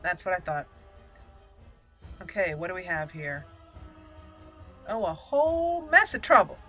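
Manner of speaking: ordinary delivery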